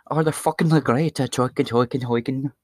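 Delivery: comic Irish-Scottish brogue sliding into gibberish; nonsense-syllable Irish-Scottish imitation; mock Irish-Scottish accent. Mimics Irish and Scottish commenters.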